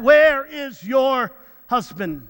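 slow, imitated Nigerian accent; strong Nigerian accent. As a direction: ask slowly and forcefully with a Nigerian accent. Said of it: "in her very strong Nigerian accent".

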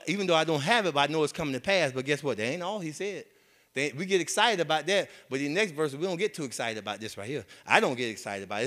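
ordinary talk

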